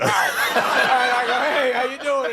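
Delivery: high, slow laughing words. Real, not acted. Retelling meeting Rickles, laughing. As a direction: laugh through the greeting.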